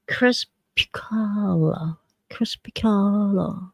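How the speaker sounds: drawn-out, careful try at a hard word; slow, hushed, feeling out Crespicala; slow attempt. Shown as a demonstration. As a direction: sound out Crespicala slowly and softly. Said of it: "I'm sure it can be said faster".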